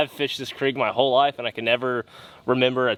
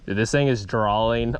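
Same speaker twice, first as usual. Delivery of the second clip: normal talking voice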